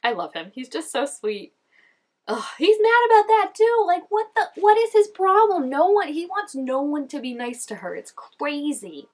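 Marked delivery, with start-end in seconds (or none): 2.3-9.1 high, loud exasperated rant; exasperated outburst. Real, not acted. Viewer fed up with Spider's meanness, high loud exasperated rant. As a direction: throw up your hands at him, pitched up and loud.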